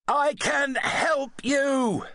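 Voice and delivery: slow, high, gentle offer; reassuring. A narrator's reading. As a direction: coax him slowly, calming him. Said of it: "the doctor told him".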